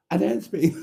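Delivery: high laughing mimic of himself; mock high voice. Host mocks Mustard's impression of him. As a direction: impersonate how Mustard does him. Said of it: "he makes me sound like a Dick Emery character".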